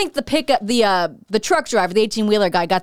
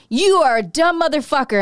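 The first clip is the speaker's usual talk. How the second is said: higher-pitched mock yell; mildly yelled, pitch raised; retold yelling; 6 semitones higher than usual. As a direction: imitate an irate trucker hurling an insult. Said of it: "this guy's still yelling at me".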